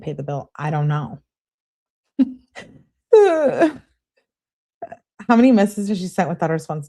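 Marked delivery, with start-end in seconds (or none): 3.1-3.8 sudden high burst of laughter; loud laugh. Real, not acted. Reacting to her friend's texts, a loud real laugh.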